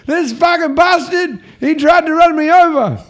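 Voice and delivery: put-on loud, high-pitched drunken outrage; yelling and screaming. Drunk man accusing the driver in McDonald's, imitated screaming. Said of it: "he's yelling and fucking screaming".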